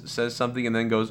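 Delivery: ordinary talk, nothing marked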